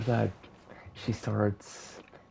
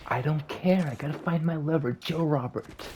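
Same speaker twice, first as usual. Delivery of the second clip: a bit higher, fast and pushy; a hint of a desperate Cinderella; 7 semitones higher than usual. Cinderella brushes off the shark, a little higher and fast. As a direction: rush it like a lovesick princess. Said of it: "And she's like".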